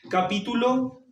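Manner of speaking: normal talking voice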